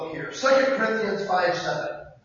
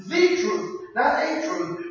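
"the truth" with "the" hit higher, against "a truth"; stress on THE; 5 semitones higher, slower than usual. Shown as a demonstration. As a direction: punch "the", not "a". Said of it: "You're speaking truth".

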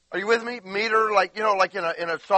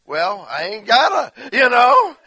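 higher, louder mock drawl of bad grammar; hammed-up slang; 6 semitones higher, 11 dB louder than usual. Shown as a demonstration. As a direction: belt out the sloppy grammar for laughs. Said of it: "you just slaughter it".

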